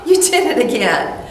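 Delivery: gently amused at herself. Shown as a demonstration. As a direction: say it softly with a laugh. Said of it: "A little softer, isn't it?".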